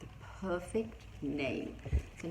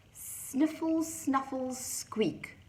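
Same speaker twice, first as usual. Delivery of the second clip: sniffle sounds; 7 semitones higher, 3 dB louder, much slower than usual. Acted. Crying noise sounds.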